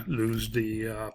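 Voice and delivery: ordinary delivery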